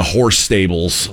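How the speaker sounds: usual speaking voice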